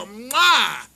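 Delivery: big loud mock kiss sound; hammed-up kiss for mom; loud smacking kiss. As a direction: smack a kiss loudly for the room. Acting kiss to mother.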